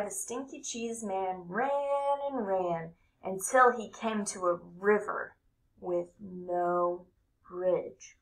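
ordinary delivery, nothing marked

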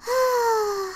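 soft, sighing pretend yawn; little yawn. A narrator's reading. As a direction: let out a small sleepy yawn. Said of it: "And then I yawned".

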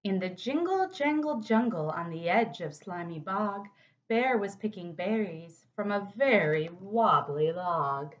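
put-on lively read-aloud for kids; animated picture-book narration; storybook reading. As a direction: read it like storytime for little ones. Reads picture book to children.

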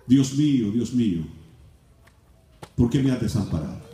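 ordinary delivery

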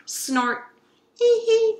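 a piggy snort then a high giggle. Acted.

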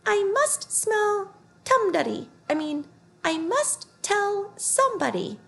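very high, slow squeaky mutter; a tiny mouse fussing to itself. A narrator's reading. Mouse scurries off muttering in a high squeak. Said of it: "hurried away muttering to itself".

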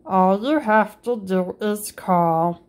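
ordinary delivery, nothing marked

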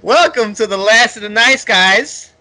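loud, high-pitched welcome breaking into laughter; over-the-top host intro with laughs; hyped show opening. Acted. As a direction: greet the crowd at full blast. Host's big show opening, laughing.

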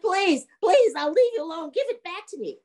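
high, pleading bargain; begging ghost. Acted. Ghost begs young man for rib cage, high pleading. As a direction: plead high, softening at the end.

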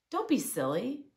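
plain reading voice

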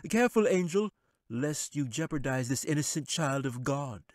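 high, sly wizard voice. Acted. Wizard dismissing the angel, sly and high. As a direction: sneer it down from the throne.

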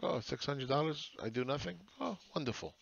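sarcastic impression of the poor cheering a small check; mock delighted. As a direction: play the grateful fool, sarcastically. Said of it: "They're like".

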